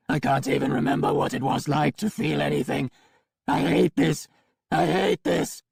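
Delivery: loud, flat, robotic screaming; flat mechanical scream. A narrator's reading. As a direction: cyborg breaking down, shouting. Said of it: "screaming in a flat mechanical voice".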